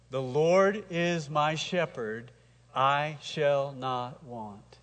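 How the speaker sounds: very slow, every word weighed; slow unison reading. Shown as a demonstration. Pastor reading Psalm twenty-three aloud with the church, slow. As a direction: read it slowly with the congregation. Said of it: "Read that with me".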